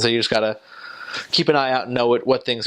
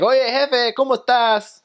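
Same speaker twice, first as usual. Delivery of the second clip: put-on much higher, lilting Mexico City accent, rising and falling; Mexico City sing-song accent; 12 semitones higher than usual. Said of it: "sing with a very sing-songy voice".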